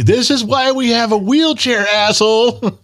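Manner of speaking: high, indignant mock scream. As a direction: berate your owner as the aching hips. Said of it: "my hips are screaming at me".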